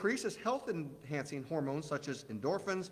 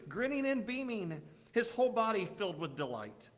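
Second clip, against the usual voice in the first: ordinary talk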